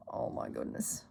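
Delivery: deep, soft, whispered groan; pained whisper. Retold or imitated. Whispering while retelling hitting the stone counter. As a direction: breathe it out as if wincing. Remembering the painful hit.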